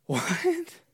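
high, gasping what in disbelief; shocked master gasps; gasped shock. Acted. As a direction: gasp then blurt it out, stunned.

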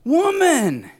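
very high, drawn-out loud cry; big booming outburst of wonder; amazed exclamation. Acted. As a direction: cry out in delighted astonishment. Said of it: "no, he's like".

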